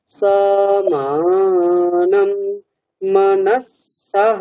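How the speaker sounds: slow, word by word chant; broken into pieces. Shown as a demonstration. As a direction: chant it in separate chunks. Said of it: "একটু কেটে কেটে বলবেন".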